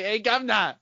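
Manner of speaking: put-on British accent; mock British accent. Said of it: "I just wanna say".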